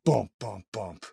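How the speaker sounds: low, separate rotor thuds; imitated rotor thumps. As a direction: imitate blades thudding to a halt. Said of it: "I hear them just go".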